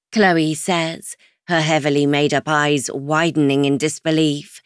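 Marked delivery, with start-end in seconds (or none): none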